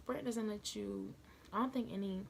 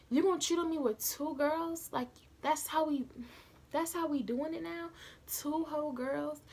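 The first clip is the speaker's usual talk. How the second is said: loud, higher, outraged scolding; angry telling-off; 6 semitones higher, 4 dB louder than usual. Retold or imitated. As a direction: go off on him, fed up and loud. Telling off cheating boyfriend.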